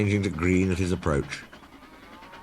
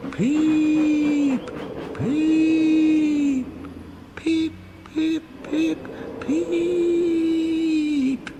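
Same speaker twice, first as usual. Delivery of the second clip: long high ghostly peep; ghostly shriek; much slower than usual. A narrator's reading. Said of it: "he shrieked".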